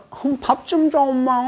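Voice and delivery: put-on high childish whine of a regressed grandmother; 어린애가 된 할머니. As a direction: play an old woman begging like a five-year-old. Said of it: "한 다섯 살 짜리로 들어갔다가". (다섯 살 아이처럼 칭얼대는 할머니 목소리)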